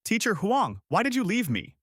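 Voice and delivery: high-pitched anguished cry out; mild scream. A narrator's reading. Lan asking her dead teacher why, understated scream. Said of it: "Lan screamed".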